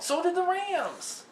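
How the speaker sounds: very high, drawn-out retort; squealed, slow argumentative jab; high-pitched comeback. Real, not acted.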